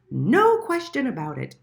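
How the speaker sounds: announcing the answer with glee; triumphant shout. A narrator's reading. The flies telling Little Mole the culprit, loud and triumphant. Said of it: "they shouted triumphantly".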